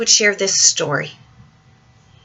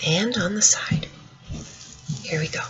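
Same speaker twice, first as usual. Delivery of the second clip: hushed; 9 dB quieter than usual. Real, not acted. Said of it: "reading to children, hushed".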